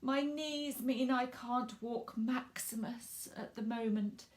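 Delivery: sighing. Acted. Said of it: "Missus Owen sighed".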